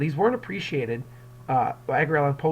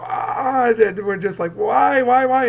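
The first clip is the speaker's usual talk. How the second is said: higher, louder mock wail; mock crying louder; 9 semitones higher, 8 dB louder, slower than usual. Parent out-crying a baby, mock wail. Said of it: "we start to cry louder".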